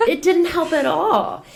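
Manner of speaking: said through high laughter; laughing. Real, not acted. Laughing at her own frustration.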